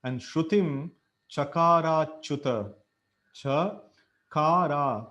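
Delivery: "cha" and "kara" with the a held long; long A sounds. Shown as a demonstration. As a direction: stretch both a's in "chakarachuta". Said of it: "both are big A's".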